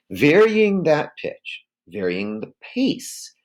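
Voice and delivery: slow, deliberate lines with the pitch swinging; slow varied delivery. Shown as a demonstration. Teacher to class, demonstrating pace, slow and deliberate. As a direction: stretch each phrase out to show variety. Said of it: "as I'm doing right now".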